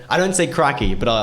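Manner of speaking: ordinary talk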